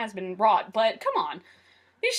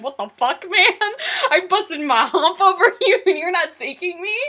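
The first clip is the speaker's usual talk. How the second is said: mock-upset protest, laughing through it; upset, with laughter; 5 semitones higher, 6 dB louder than usual. Fan voicing Bumblebee left behind, comically upset. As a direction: protest indignantly, breaking into a laugh. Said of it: "he was very upset about that".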